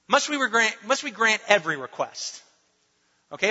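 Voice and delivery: ordinary delivery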